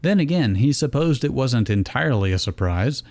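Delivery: ordinary delivery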